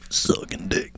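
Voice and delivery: unvoiced whisper; whispered joke aside. Podcaster sneaking in a crude joke, whispered. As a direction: slip it in under the breath.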